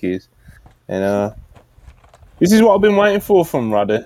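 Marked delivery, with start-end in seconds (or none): 2.4-4.0 excited. Real, not acted. Excited about Roddy promo.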